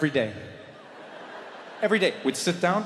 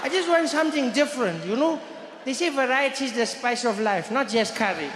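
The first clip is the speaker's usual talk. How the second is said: higher-pitched friend's voice; 7 semitones higher than usual. Retold or imitated. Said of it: "And he said".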